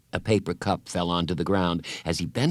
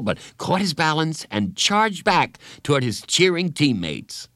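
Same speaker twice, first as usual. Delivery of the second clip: ordinary delivery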